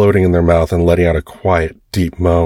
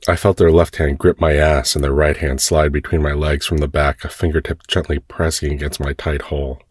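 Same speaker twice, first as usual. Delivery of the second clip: usual speaking voice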